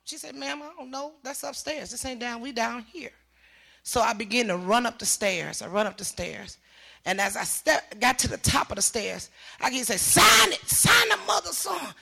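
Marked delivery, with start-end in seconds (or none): 0.4-3.1 much softer retold voice; dismissive desk reply. Quoting indifferent desk lady's reply. 10.0-11.9 re-enacted forceful order. Acting out officer beating son.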